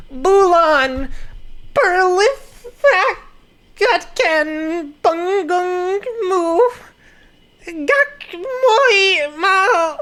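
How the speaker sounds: very high, slow, strained, tearful character voice; dramatic anime reading. Acted. Reading Indonesian comic lines aloud, high sobbing overacted voice. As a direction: wail the lines like a crying anime heroine. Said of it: "I'm gonna try to read this".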